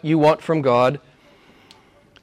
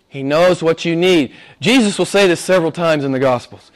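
normal talking voice

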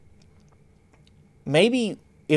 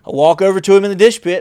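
normal talking voice

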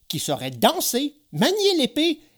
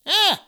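short, very high yelp; cry of pain; 4 dB louder than usual. Acted. Geppetto is struck on the shins, pained yelp. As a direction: yelp as if hit on the shin.

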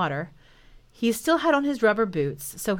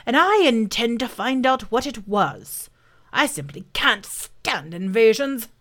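lightly voiced indignant boar; a touch of a self-important boar; 3 semitones higher than usual. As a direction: put on a slightly huffy boar voice.

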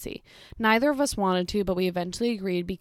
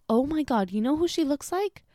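imitated excited mother, higher pitched; gushing mother; 6 semitones higher than usual. Mother remarking on a lookalike to her daughter, imitated gushing. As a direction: pitch it up like a chatty customer. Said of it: "then the mother was like".

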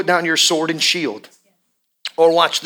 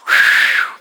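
unvoiced whoosh; 4 dB louder than usual. Real, not acted. Preacher exhales mid-sermon.

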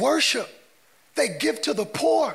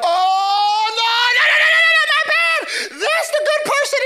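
loud, high-pitched frantic squeal; hammed-up panic at a plot twist; mock shocked realization; 7 dB louder than usual. Moviegoer finding out the villain is good, hammed-up shock.

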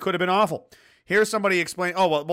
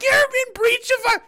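squeaky high mock officer voice; mimicking the officer; 6 dB louder than usual. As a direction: parrot her in a tiny high voice. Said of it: "she's just there like".

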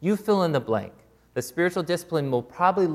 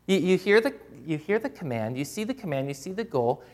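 normal talking voice

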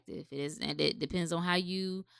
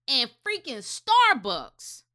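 usual speaking voice

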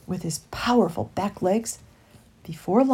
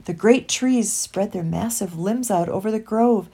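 ordinary delivery

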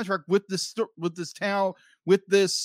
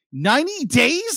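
incredulous; 7 semitones higher than usual. Real, not acted. Reacting to the lease deadline.

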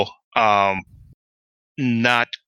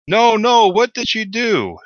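high-pitched cry of alarm, starting loud and drawing out the end; Shapiro yelling; 12 semitones higher, 4 dB louder, slower than usual. Acted. Game master voicing a character at the gate room, yelling. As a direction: yell it high, like someone reacting to a mishap. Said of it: "you hear Shapiro start sc-, yelling".

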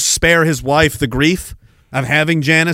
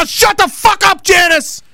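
much higher, loud furious yell; furious shout; 4 dB louder than usual. Real, not acted. Streamer cutting off Janice with a furious shout. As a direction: scream her down. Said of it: "snapping at Janice".

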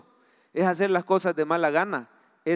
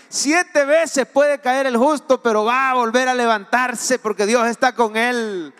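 louder, building fervent proclamation; preaching climax; 3 semitones higher, 5 dB louder than usual. Acted. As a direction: build to a shout of hope. (predicación in crescendo, más fuerte)

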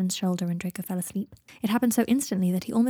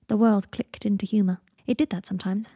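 ordinary delivery, nothing marked

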